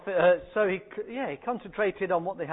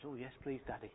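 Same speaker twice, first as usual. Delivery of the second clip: put-on child's voice; 6 semitones lower, 15 dB quieter than usual. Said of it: "and says".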